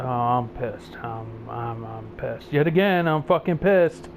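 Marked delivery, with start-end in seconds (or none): 0.0-2.5 low, soft and slow, holding back anger; quietly seething. Real, not acted. Gamer on camera, seething quietly about the shootings. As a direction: mutter it under your breath, barely contained.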